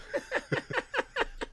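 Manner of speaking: high unvoiced wheeze laugh; breathy laugh. Real, not acted.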